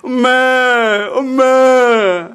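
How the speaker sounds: drawn-out mooing cry; calf calling mother. Acted. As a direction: stretch "mama" into a calf's bawl. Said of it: "엄마를 부르는 거예요". (길게 우는 송아지 소리)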